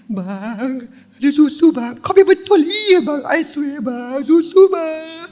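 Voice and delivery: high, choked joyful pleading; half-crying good news to husband; tearful excitement. Retold or imitated.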